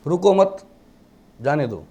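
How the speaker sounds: रुको मत grouped together, pause, then जाने दो falling; pause after मत. Shown as a demonstration. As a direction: break after मत, let जाने दो drop. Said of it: "इतनी सी लाइन को कोमा आगे पीछे कर दोगे".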